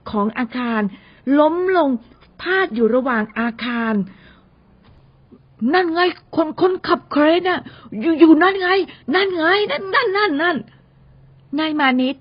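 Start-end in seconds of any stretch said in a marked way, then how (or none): 5.7-10.6 higher, hurried, tripping over words; stammering in panic. Acted. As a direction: point and stammer in alarm. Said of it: "พูดระล่ำระลัก". (พูดระล่ำระลัก เสียงสูง ตกใจ)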